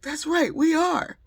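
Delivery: shouted high and loud all at once; excited cheer. Retold or imitated. Airline staff agreeing with a loud, high cheer. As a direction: call it out like the best news all day.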